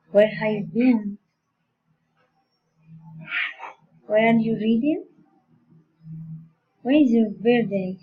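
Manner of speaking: polite question tune, lifting "reading" at the end; rising, friendly interest. Shown as a demonstration. Teacher modelling friendly, polite questions, rising intonation. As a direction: let "been", "reading" and "birthday" rise with interest. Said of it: "rising intonation".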